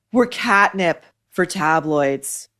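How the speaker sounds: ordinary talk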